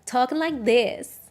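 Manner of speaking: hammed-up American teen-drama accent; soap opera American accent. Shown as a demonstration. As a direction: play the popular American girl from a soap. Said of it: "put on the same accent".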